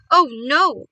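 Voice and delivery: loud cry of refusal; dismayed cry. A narrator's reading. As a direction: cry out in horror, good and loud. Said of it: "cried Philip".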